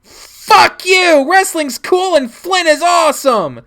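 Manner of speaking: put-on high, loud, defiant child's scream; furious screaming. Child defending friend to dad, furious scream. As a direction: scream back at the top of your voice.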